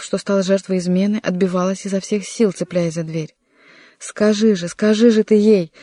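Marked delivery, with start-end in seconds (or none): 4.0-5.7 raised, urgent appeal; shouting. A narrator's reading. Young man urging servant to explain, raised urgent shout. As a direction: press him with a building cry. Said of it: "закричал Анри".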